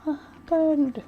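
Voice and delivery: high, loud startled yelp; shocked Rei. Retold or imitated. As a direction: squeak it in disbelief. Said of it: "He'd be like".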